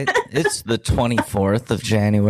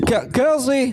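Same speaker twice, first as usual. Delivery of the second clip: calling out; 14 semitones higher than usual. Pretend. Doctor Phil character bursts in calling.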